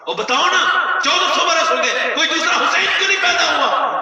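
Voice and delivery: high, loud challenge with a laugh in it; loud taunting repeat. Retold or imitated. Official repeating her question to the crowd, loud and laughing. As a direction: press them again, half laughing. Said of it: "اس نے پھر دوسری بار کہا".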